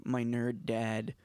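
ordinary talk

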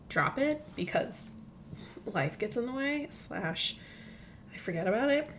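burping while talking; words broken by burps; talking through burps. Real, not acted. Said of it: "talking through burps".

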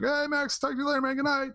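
high, friendly mock streamer voice; streamer greeting Max. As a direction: sing it up high to a little kid. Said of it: "other streamers that will send him a message".

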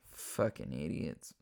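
low scornful mutter; muttered insult. Real, not acted. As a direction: mutter it off to the side in disgust. Muttered insult after rant.

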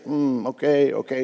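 soft, low imitation of hesitant waiting; passive murmur. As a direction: voice someone idly waiting, very quietly. Voicing passive believers standing waiting.

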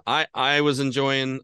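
normal talking voice

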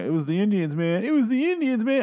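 lightly voiced cowboy, urgent and jittery; a loose, hammed-up warning that it was the Indians, man; Bill Paxton impression. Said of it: "I'm gonna do a little, uh, Bill Paxton there".

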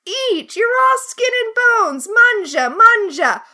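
high, loud Italian nonna, pushing food; Italian grandmother. Retold or imitated. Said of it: "which is an Italian grandmother stereotype".

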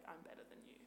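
unvoiced smug aside; whispered superiority. Woman showing the superior tone to avoid, whispered. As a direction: breathe it condescendingly. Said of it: "coming from a place of".

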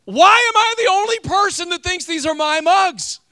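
high, loud, slow exasperated wail; exasperated cry. Retold or imitated. Pastor retelling being outnumbered over mugs, exasperated. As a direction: plead like nobody believes you. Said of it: "I was like".

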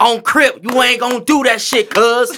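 hammed-up Brooklyn Crip accent. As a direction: put on a Brooklyn Crip threat, half laughing. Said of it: "I sound like a Brooklyn Crip".

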